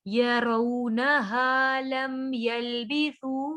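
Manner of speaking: slow, drawn-out tajweed recitation; Quran recitation. Shown as a demonstration. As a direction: recite it with ghunna on the noon. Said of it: "मद करेंगे इस पे". (धीमी तजवीद वाली तिलावत)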